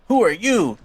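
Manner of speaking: high, loud surprised question; startled Sonic. Acted. Sonic meeting Chris, high and loud surprise. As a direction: demand it, startled. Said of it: "he's like".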